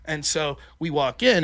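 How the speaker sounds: usual speaking voice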